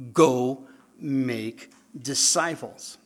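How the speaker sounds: preacher stating Jesus's command; flat-out command. Real, not acted. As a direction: Go make disciples slow and firm. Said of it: "telling us flat out".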